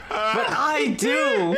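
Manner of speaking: slow, laughing half-shout. Real, not acted. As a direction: laugh it out while confessing. Laughing at own guilt.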